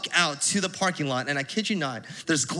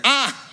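startled yelp; 7 semitones higher, 5 dB louder than usual. Retold or imitated. Light shined in face.

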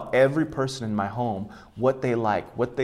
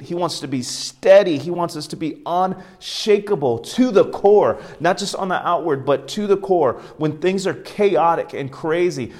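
higher, louder preaching; impassioned preaching; 5 semitones higher, 4 dB louder than usual. Acted. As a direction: preach it out with conviction. Preaching at full force.

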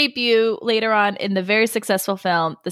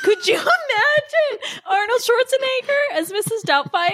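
high-pitched, laughing through the question; laughing disbelief; 13 semitones higher than usual. Real, not acted. Cohost cracks up picturing Arnold as Mrs. Doubtfire, high laughing squeal.

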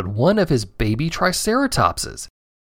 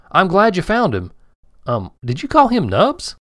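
higher, amused farmer; 6 semitones higher than usual. Acted. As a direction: ask with amused doubt. Farmer replies.

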